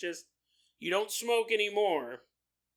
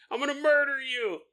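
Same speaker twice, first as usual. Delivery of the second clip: imitating amused friend; 8 semitones higher, 5 dB louder than usual. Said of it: "Jason's gonna be like".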